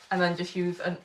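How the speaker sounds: usual speaking voice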